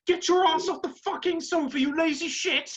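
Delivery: high mock-furious coach screaming at a lazy player; angry boss yell. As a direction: yell him off the sofa. Boss yells at lazy quarterback.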